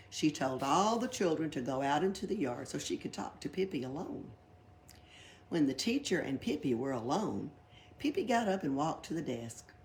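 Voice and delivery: ordinary delivery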